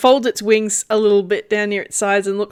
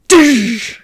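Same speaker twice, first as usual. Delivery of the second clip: landing thud; 3 semitones higher, 7 dB louder than usual. Acted. Bird lands on the ground.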